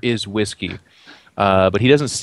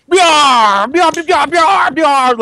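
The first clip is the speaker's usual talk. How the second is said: high, loud pirate shouts; loud pirate yells; 7 dB louder, much slower than usual. Acted. Crew of little pirates shouting from the boat, loud and high. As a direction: fire off loud pirate R's. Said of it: "kind of a loud".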